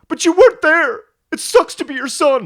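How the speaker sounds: high, shouted soap-opera outburst; hammed-up son yelling at his mother; aggrieved son. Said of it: "him being very skeptical about her".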